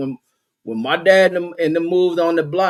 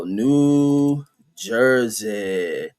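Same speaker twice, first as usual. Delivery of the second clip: drawn out slowly; 4 semitones lower, much slower than usual. Real, not acted. Typing slowly while searching.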